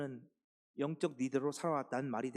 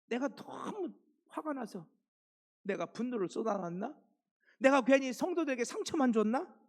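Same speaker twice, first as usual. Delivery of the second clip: Paul doubting his harsh letter, rising to an anguished question; regretful self-questioning; 9 semitones higher, 3 dB louder than usual. Acted. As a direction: ask yourself in anguished regret. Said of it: "몇 번이고 후회했다는 거예요".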